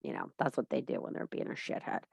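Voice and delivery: hushed, breathy aside to the viewers; conspiratorial whispered dig. Real, not acted. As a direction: whisper it as a cheeky jab at the husband. Sly aside about husband.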